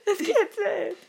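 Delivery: laughing while tickled. Real, not acted. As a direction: speak through giggles.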